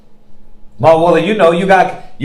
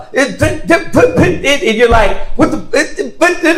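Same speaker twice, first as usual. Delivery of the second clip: high-pitched, broken stammer of rage; mock angry sputtering; 7 semitones higher than usual. Parent screaming at kids, mock angry sputter. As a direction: sputter half-words as if too mad to talk. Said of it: "Then you screaming, you're angry".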